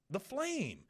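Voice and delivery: put-on high, awed boy's voice on flame; a kid's reverent whisper of wonder; Bobby voice. Boy naming the torch's flame in awe. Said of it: "Bobby goes".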